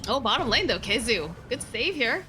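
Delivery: loud, rising caster call; excited play-by-play. Acted. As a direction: call the fight as it heats up.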